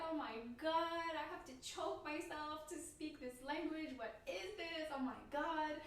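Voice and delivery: high-pitched "oh my God" outburst; mock dismay. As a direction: act out exasperated disbelief at the absurdity. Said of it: "I was like".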